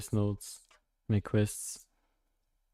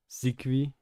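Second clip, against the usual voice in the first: German-accented name, pronounced slowly; 3 semitones higher, 5 dB louder than usual. Shown as a demonstration. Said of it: "German accent heavy".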